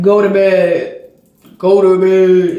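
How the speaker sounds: a hint of a stern father laying down the law; imitating the dad's command, low and firm. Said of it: "He just has a stern voice".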